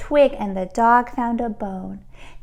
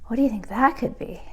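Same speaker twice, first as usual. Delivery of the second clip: ordinary delivery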